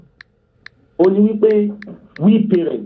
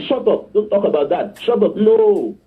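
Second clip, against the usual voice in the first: higher-pitched parental telling-off; mild scolding, pitched up; mock shouting down; 5 semitones higher than usual. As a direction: act out shutting a child up. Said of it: "You should not shout them down".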